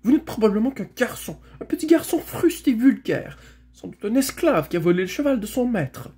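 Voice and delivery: high, haughty tirade; scornful accusation. Acted. Girl accusing Shasta of theft, high and scornful. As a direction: hurl the insults in a high voice.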